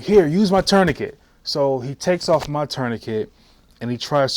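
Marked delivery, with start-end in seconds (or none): 0.0-1.2 urgent shout. Retold or imitated. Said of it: "I'm like".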